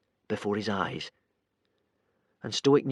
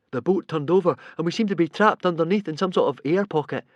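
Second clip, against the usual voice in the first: explaining; 5 semitones higher than usual. A narrator's reading. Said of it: "explained Fishlegs".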